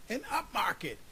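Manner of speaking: high-pitched cry at moderate volume; mild yell. A narrator's reading. Institutional investor hearing the news, mild yell. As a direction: call it out gladly without full force. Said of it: "yelled the institutional investor".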